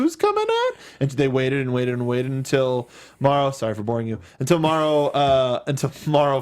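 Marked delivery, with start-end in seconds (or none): 0.0-0.7 high confused question. Retold or imitated. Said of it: "I was like".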